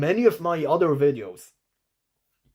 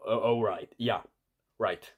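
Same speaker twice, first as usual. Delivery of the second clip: ordinary talk, nothing marked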